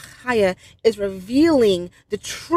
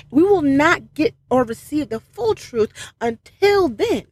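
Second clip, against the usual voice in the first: ordinary delivery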